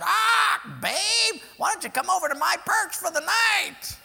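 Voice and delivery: high, loud squawky come-on; lewd parrot. Acted. Parrot Joe hitting on the lady parrot, loud high squawk. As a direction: leer at the new parrot.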